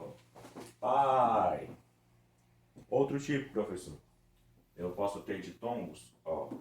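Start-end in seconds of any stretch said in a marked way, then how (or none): 0.8-1.8 "Pai" loud, falling from the a. Shown as a demonstration. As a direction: belt out pai. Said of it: "começo de uma mais forte e desço". ("Pai" alto, caindo)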